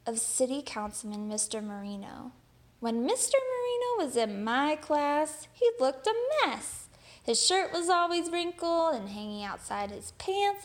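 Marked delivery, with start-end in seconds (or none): 2.8-10.6 a lightly raised, amused teacher voice recalling a student; teacher reminiscing. Acted. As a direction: lift the voice as the teacher mocks his messy clothes. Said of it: "There's a story".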